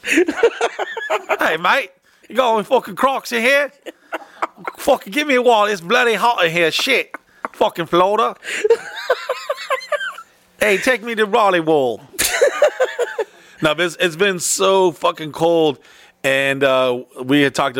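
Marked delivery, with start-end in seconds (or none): 0.0-8.3 mock Australian accent, laughing through it. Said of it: "the Australian accent was from the inland". 10.6-12.0 put-on lightly kept Australian accent, laughing; a fading mock Australian accent; half-laughed Australian tourist. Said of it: "the Australian accent". 12.2-13.2 big laugh. Real, not acted. Laughing at own bit.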